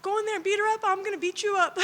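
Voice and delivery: lightly voiced, pumped-up grandma urging her to fight; imitating grandma. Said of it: "My grandma would say".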